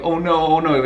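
reliving the panic after hitting the dog; panicked. Retold or imitated.

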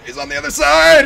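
high, loud cry of alarm; alarmed shout. Real, not acted. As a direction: shout it in a panic. Spots killer close in game.

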